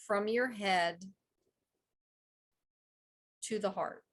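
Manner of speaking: slow, spaced-out meditation leading; guided meditation. Real, not acted. Guide asks listeners to close their eyes, slow meditation voice. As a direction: guide them into the breathing slowly, with long pauses. Leads heart-focused breathing.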